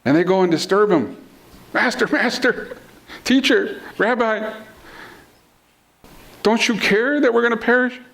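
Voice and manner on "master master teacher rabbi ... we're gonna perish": high-pitched, acted panic; panicking disciples. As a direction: plead for help, mock-panicked. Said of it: "and they're panicking".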